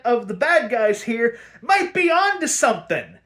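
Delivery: high, loud, emphatic sarcasm; exasperated rant. Real, not acted. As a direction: argue it loudly, as if painfully obvious. Rant voicing Batman and sensible reaction.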